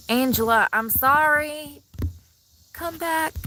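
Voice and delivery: high, drawn-out plea calling after her; pleading call. Real, not acted. To Angela who left the chat, high pleading call.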